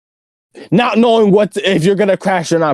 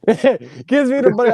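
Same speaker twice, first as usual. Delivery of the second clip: usual speaking voice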